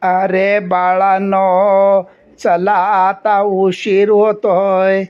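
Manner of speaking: put-on very slow, quavering old grandpa voice; a little girl playing a shaky old grandfather; creaky slow elder calling the kids; trembling old man. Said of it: "थरथरत्या आवाजात म्हणाली".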